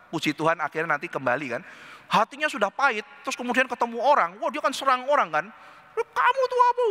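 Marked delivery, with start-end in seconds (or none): 6.0-6.8 high sharp mock attack; mock snapping attack. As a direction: snap it high at someone. Bitter man attacks someone. (bentakan tinggi pura-pura)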